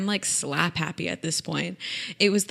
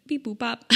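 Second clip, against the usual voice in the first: higher little robot bleeps; playful computer beeps; 5 semitones higher, 4 dB quieter than usual. Pretend. Podcaster finding her lost train of thought, computer beeps. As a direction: chirp it like a machine thinking.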